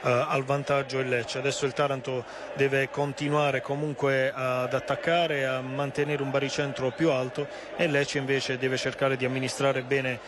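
ordinary delivery

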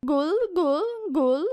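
high gobble; turkey gobbling. Acted. Reading the poem to kids, a high turkey gobble. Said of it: "Indor gulgocze".

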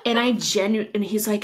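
ordinary talk

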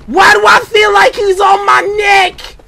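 loud, high, frantic question; panicked shout. Real, not acted. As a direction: yell it with the chaser right behind you. Pursuer close behind in game.

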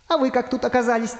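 high-pitched astonished comeback; amazed character voice, pitched high; surprised. Acted. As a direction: throw the question back in amazement. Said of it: "удивлённо спросил Дырка".

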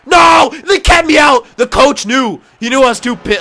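high, loud protest, easing slightly; indignant shouting. Real, not acted. Player protesting that the coach kept him out, indignant shouting. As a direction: yell in real protest.

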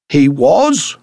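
high, loud rising question; inquiring call. A narrator's reading. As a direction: call it out as a puzzled query. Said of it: "called Fisher inquiringly".

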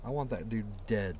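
usual speaking voice

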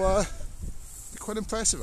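normal talking voice

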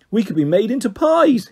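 half-playful try to frighten a friend; hammed-up scary tone; pretend scary voice. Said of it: "he said in a pretend scary voice".

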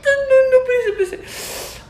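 high panicked squeals, laughing and crying at once; panicked pleading. Real, not acted. Scared of the flu shot, squealing high and laughing through it. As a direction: beg the nurse to wait, squealing.

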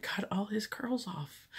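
hushed, confiding whisper. Real, not acted. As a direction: whisper it like a scandalous secret. Confiding the shocking haircut.

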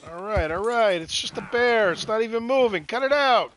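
tense, trying to calm things; alarmed. Real, not acted. Horse panics near a bear.

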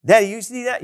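put-on high, eager little kid calling to a parent; excited child. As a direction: play the kid begging Mommy and Daddy to watch. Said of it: "our kids would do something and they go".

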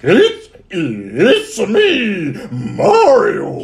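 high, loud, slow stretched Mario; Mario impression. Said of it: "[name] doing a Mario impression".

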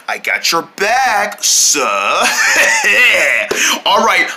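usual speaking voice

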